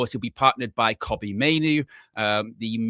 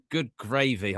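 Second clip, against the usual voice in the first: normal talking voice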